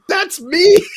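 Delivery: high, loud triumphant exclaim; gleeful reveal. Retold or imitated. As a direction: announce it with delighted glee. Revealing his voice in game.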